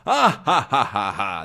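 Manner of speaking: mock villain "ha ha ha", evenly separated; acted ghostly laugh. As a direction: laugh to frighten your captive. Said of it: "And he laughs".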